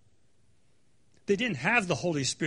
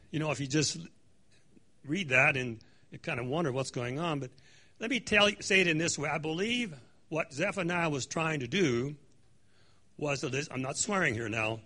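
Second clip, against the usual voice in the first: ordinary delivery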